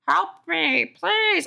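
high, panicked pleading; shrill frightened plea. Acted. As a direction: beg for help, high and scared. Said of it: "pleaded in a shrill, frightened voice".

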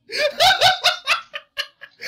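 squealing laugh; can't stop laughing; high laughing fit. Real, not acted. Laughing at his own botched accent, high squealing laugh.

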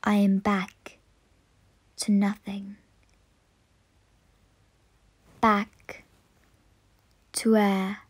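slow, low and soft with long pauses; fading away. Acted. Air returns to nothing as breath ends, fading away. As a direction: let it sink slowly into a final whisper.

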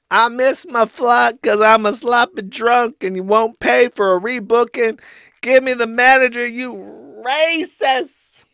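high, loud mocking tantrum; hammed-up outraged shouting; Ethan Ralph. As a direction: yell like a sloppy drunk demanding service. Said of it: "This is me making fun of him".

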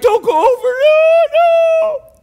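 very high, loud crying shout of warning; anguished cry. Retold or imitated. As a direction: cry out to stop the sheep in anguish. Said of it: "I'm like".

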